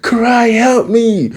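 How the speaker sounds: very high, drawn-out plea; hammed-up wail for rescue; mock cry for help. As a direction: call out like a captive hero. Acting Leo tied up, calling out.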